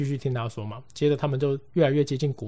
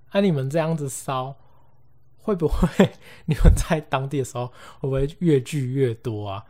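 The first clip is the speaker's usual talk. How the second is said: teasing; 5 dB louder than usual. Retold or imitated. Teasing friends with spooky question.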